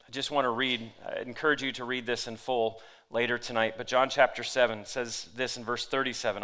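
usual speaking voice

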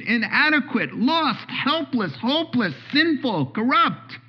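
high-pitched, emphatic preacher delivery; high, urgent pulpit voice; impassioned preaching. Acted. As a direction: preach it hard, driving each point home. Preaches at full force.

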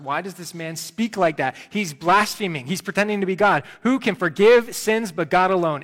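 mildly outraged muttering turned loud; lightly voiced indignant religious men; indignant scribes. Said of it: "questioning in their hearts".

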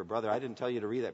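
ordinary talk

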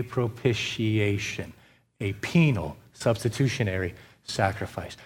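low, hushed restatement of "penal substitutionary sacrifice"; soft low conclusion. Acted. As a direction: settle the phrase down quietly, like a final verdict. Preacher closes point softly.

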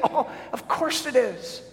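chuckling, pitch lifted high; amused reassurance with a laugh. Real, not acted. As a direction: laugh it off as obvious. Answers own question, laughing.

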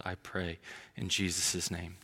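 soft, hushed prayer ending; closing prayer. Real, not acted. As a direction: close the prayer quietly and reverently.

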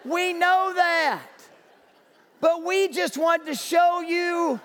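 eager to be appreciated, half shouting; earnest townsperson. Acted. Spokesperson to firefighter, slow earnest half shout.

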